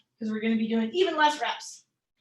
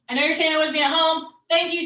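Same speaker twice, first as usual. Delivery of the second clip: ordinary talk, nothing marked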